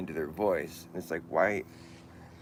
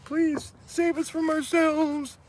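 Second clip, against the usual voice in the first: very high, loud, drawn-out cry; mock desperate plea; 9 dB louder, much slower than usual. Poet begging to be saved, high mock plea. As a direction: beg slowly in a far higher put-on voice.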